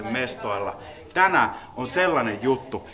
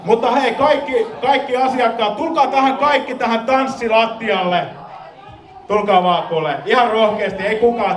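rally the whole room onto the floor; excited calling out; 8 semitones higher than usual. Real, not acted. Calls crowd to the dancefloor. (innostunut kutsuhuuto)

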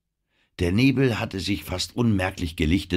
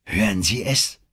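ordinary delivery, nothing marked

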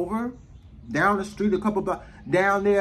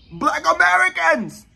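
loud, high and drawn out; emphatic outburst; 12 semitones higher, 7 dB louder, slower than usual. Real, not acted. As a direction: belt it out to set the record straight. Emphatic correction of who did it.

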